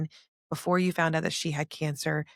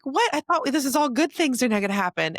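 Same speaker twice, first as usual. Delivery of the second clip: higher, louder retold protest; mock shock; 8 semitones higher, 5 dB louder than usual. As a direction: play up the disbelief, lifted high and loud.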